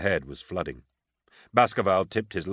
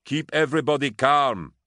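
loud, raised shout back; calling back; 8 semitones higher, 6 dB louder than usual. A narrator's reading. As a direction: call the order down to someone below. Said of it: "Bonin called back".

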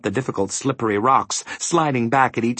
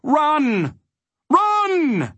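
high, drawn-out urgent call; crying out. A narrator's reading. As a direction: call out far higher than usual, holding the word. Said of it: "a voice cried out".

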